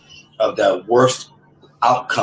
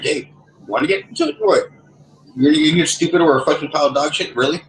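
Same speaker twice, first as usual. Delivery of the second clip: raised pitch, challenging the neighbor; mock heated taunt; retold angry confrontation; 7 semitones higher than usual. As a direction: pick a fight with him.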